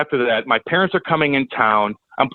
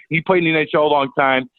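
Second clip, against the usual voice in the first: ordinary talk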